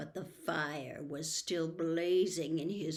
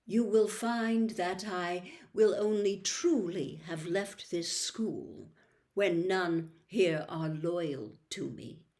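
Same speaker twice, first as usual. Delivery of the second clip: slowly and clearly. Acted. Dumbledore speaks to all, slow and clear. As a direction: make every word land. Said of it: "speaking very slowly and clearly".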